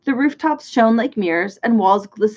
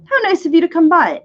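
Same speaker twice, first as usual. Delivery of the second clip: bright, raised welcome; a touch of eager exclaiming; 7 semitones higher, 5 dB louder than usual. A narrator's reading. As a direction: greet them with delight. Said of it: "exclaimed the man".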